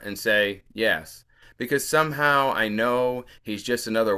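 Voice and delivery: measured, lilting poem recital; poetry reading. Acted. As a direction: read the poem aloud with deliberate phrasing. Reads own poem aloud.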